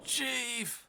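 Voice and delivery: high, falling cry of "Chief!"; drawn-out high call of dismay; alarmed cry. Acted. Character finds the chief.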